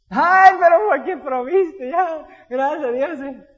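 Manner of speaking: flustered excitement. Retold or imitated. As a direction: babble with happy surprise. Answering God, flustered.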